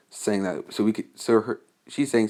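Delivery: ordinary delivery, nothing marked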